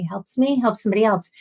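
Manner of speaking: ordinary talk, nothing marked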